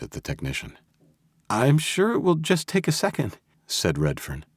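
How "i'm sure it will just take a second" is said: high, shrill nervous reassurance. Acted. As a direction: squeak it out nervously. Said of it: "hating the shrillness in his voice".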